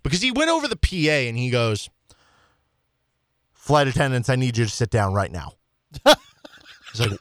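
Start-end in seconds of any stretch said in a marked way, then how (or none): none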